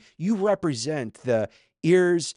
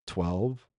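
small, lower, sheepish answer; tail between legs; 4 semitones lower, 4 dB quieter than usual. Acted. Disciples answering Jesus's question, tail between their legs. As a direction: answer like a chastened pupil. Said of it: "answering with tail between their legs".

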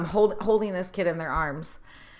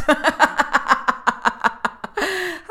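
bright high burst of laughter at her own joke; amused high laugh; 9 semitones higher, 5 dB louder than usual. Real, not acted. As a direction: laugh out loud, high and free.

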